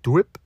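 mouth-made skid and crash; car crash sound effect. Acted. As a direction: imitate a car crashing through a wall. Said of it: "That was the sound effect of me crashing".

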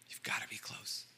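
fully unvoiced whisper on close. Shown as a demonstration. Preacher to congregation, whispering the repeat. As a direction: breathe it like a secret. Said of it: "in order to hear a whisper".

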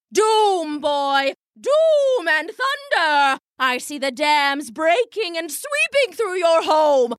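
high, theatrical stage prophecy; grand fortune teller drowning out the boy; doom-laden soothsayer. Acted. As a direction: bellow out doom like a stage oracle.

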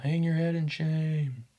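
mock heckle shouted from the floor; retold yell. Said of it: "Jack yells out".

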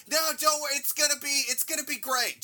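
high-pitched mock promise; mock reassurance. Voicing a mocking promise to voters.

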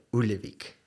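usual speaking voice

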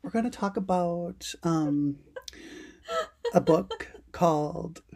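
halting, unsure podcast opening; mock-uncertain host, slowing to a crawl; imitated hesitancy. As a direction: imitate fumbling for the topic, pausing between phrases. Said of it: "you sounded, like, so confident. Where other times".

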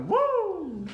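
high wrestler's whoop; Ric Flair. Retold or imitated. Imitating a favorite wrestler, soaring woo. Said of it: "I love me some Ric Flair going".

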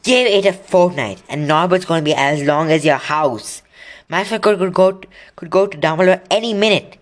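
mildly loud pressing appeal; loudly. Acted. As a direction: raise your voice to get through to him. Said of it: "said Harry loudly".

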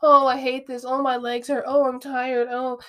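hammed-up whiny moan about legs and tiredness; mock whiny complainer. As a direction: mimic a chronic complainer, whining. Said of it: "someone's always complaining".

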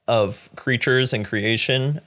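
ordinary talk, nothing marked

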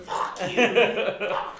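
loud laughing curse at a friend; mock yell. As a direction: bark it out, half laughing. Retold cursing at Josh.

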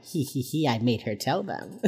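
tiny, hushed sneaky tee-hee voice; mock gleeful giggle. Will standing behind annoyed J J, quietly gleeful. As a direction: say it softly, secretly pleased with yourself. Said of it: "just standing there behind her like".

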